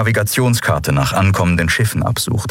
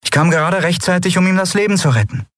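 lightly voiced woman, higher, rushing at the end; Endriel, earnest; 7 semitones higher, faster than usual. Endriel tells Lien how she saved Kai, higher and hurried. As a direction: tell it quickly, urgently.

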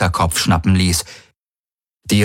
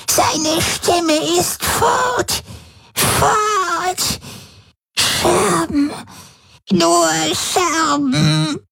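much higher, slow, tearful wail; a broken old woman sobbing; wailing and whimpering; much slower than usual. A narrator's reading. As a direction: wail in grief as the voice is lost. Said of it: "Sie heulte auf".

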